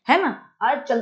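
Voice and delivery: ordinary talk, nothing marked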